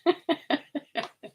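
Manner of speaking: high, bursting giggle; sudden high giggle; embarrassed laugh. Real, not acted. Embarrassed about long underwear.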